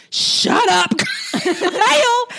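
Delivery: high, loud mocking snap; hammed-up mean shut-up laughing; mean shout. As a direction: bark it rudely at her. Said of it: "Kim turns on her and says".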